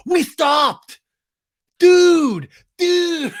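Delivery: high, whiny mock shout; Sandoval impression. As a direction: yell it as a hammed-up desperate protest. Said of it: "And Sandoval goes".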